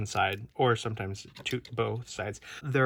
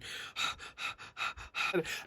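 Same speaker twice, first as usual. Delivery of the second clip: real breathy huffs, winded; winded, wordless puffing; out-of-breath panting; 7 semitones higher, 8 dB quieter than usual. Real, not acted. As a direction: huff and puff for air. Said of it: "I guess I'm out of breath".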